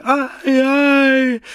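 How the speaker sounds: long, very high, loud scream; throat-cut shriek. A narrator's reading. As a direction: scream as if your throat were being cut. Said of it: "als würde ihr die Kehle durchgeschnitten".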